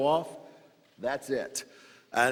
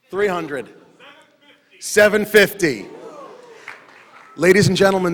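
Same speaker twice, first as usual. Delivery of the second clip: auctioneer; 5 dB louder than usual. Real, not acted. Auctioneer calling the bid.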